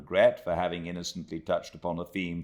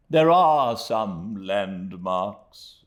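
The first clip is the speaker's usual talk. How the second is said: fond Micawber; 5 semitones higher, 8 dB louder, much slower than usual. A narrator's reading. Micawber voiced, looking fondly back.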